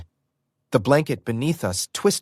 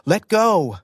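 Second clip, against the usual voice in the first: shout; 7 semitones higher, 4 dB louder than usual. Acted. Shouting in the struggle.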